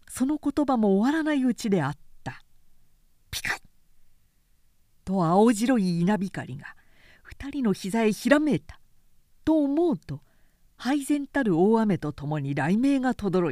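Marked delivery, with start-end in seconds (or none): none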